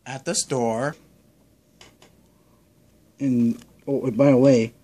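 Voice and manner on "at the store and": ordinary talk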